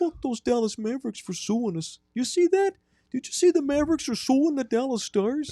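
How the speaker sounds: put-on high-pitched Wisconsin hockey accent; high, sing-song Midwestern creep. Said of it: "I got locked into characters".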